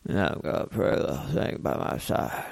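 low, soft, sleepy country mumble; half awake. Shown as a demonstration. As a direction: slur it like you're barely awake, trying to sound smooth. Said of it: "talk like you're half awake".

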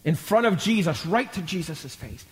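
ordinary talk, nothing marked

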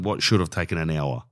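ordinary talk

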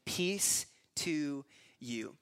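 "Peace to you" drawn out word by word; slow, solemn greeting. Acted. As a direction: stretch "Peace... to... you" very slowly. First reading of Jesus' greeting.

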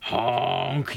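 long, drawn-out truck honk. Acted. Dump truck pushing through on the road, truck voice. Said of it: "That's my truck voice".

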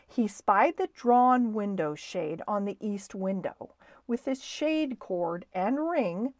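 ordinary delivery, nothing marked

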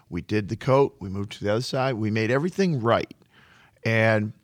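ordinary talk, nothing marked

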